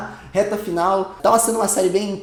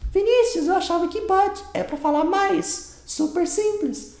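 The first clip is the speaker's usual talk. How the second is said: high put-on student voice, much higher; mock student voice; 11 semitones higher than usual. Confused student asking the teacher, high mock voice.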